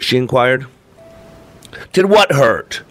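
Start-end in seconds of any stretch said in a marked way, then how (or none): 1.9-2.8 high-pitched exasperated question; sharply raised pitch, fed up. A narrator's reading. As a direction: throw the question back in irritation. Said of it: "I was exasperated".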